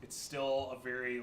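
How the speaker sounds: usual speaking voice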